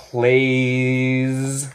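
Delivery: plays stretched long with a zzz ending; buzzing final S. Shown as a demonstration. Teacher shows plays ends like a bee, buzzing final S. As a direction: draw out "plays" and buzz the S. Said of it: "también como abeja".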